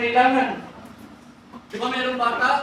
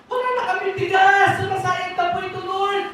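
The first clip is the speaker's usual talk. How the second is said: high pleading prayer; 7 semitones higher than usual. Acted. Voicing plea to the Lord.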